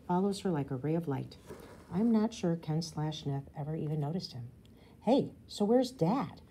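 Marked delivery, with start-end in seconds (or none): 5.0-6.4 loud, slow demand; a kid loudly demanding her dad; yelling. A narrator's reading. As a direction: practically yell it at him. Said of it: "Rue practically yells".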